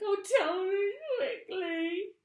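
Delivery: very high, loud sobbing plea; tearful cry. A narrator's reading. Mrs Twit begging her husband in a high tearful voice. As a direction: beg through tears. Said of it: "she cried".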